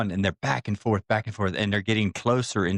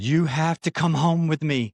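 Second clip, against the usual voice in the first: higher, insistent plea; imploring, pitched up; passionate retold insistence; 7 semitones higher, 4 dB louder than usual. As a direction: act out begging someone along. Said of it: "she starts getting more passionate about it".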